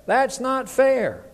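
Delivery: put-on high, drawn-out kid's complaint; mock childish protest, high and slow. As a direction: imitate a child protesting to parents, each word stretched. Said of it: "I kept telling my parents over and over".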